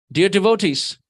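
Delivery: ordinary delivery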